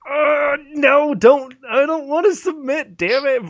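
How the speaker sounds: high, loud, whiny refusal; exasperated mock protest. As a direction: groan and balk like a reluctant kid, hammed-up. Acting out own reluctance to submit.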